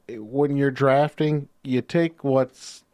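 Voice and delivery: ordinary delivery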